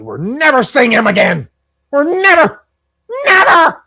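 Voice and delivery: building from firm to a high shouted never; indignant vow. Retold or imitated. Mimicking Lutnick and wife storming out, shouting never. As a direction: swear it off, rising into a yell. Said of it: "we walked out and we said".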